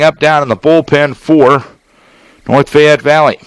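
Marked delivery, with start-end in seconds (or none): none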